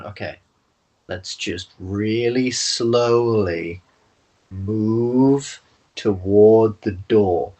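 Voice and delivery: drawn-out words, creeping along; slow, cautious creep. Acted. Party creeping toward the door, very slow and drawn out. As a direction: stretch it out as if inching forward.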